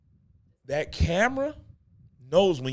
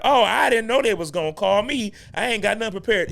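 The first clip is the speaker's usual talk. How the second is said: higher, louder caught-off-guard church member; flustered churchgoer; 8 semitones higher, 6 dB louder than usual. Retold or imitated. Church member called up for testimony, higher and louder flustered. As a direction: play the startled one called up for testimony. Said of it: "they be like".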